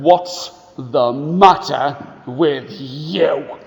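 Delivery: put-on slow, low mean-boy growl; a bully looming over a crying child; slow, gruff mean boy. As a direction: sneer it slowly at the little boy. Mean boy's question.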